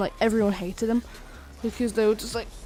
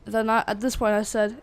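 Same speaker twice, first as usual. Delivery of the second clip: normal talking voice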